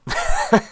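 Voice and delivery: a sudden squeaky burst of laughter; high-pitched laugh. Real, not acted. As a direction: laugh high and short at the story. Laughs at his own story.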